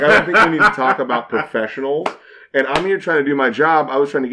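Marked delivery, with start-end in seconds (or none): 0.0-2.1 fast, louder, laughing through it; laughing jab at the musician; laughing retort. Retold or imitated. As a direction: snark back while cracking up. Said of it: "I'm like".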